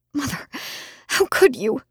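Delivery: a small pleading whimper, barely held together. A narrator's reading. Daughter whimpering at her mother who kept the secret. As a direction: whimper it at her, hurt and betrayed. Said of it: "I whimpered".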